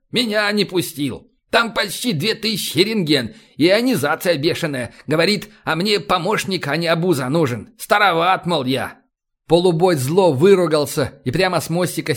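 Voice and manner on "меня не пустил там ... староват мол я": usual speaking voice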